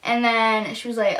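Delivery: ordinary talk, nothing marked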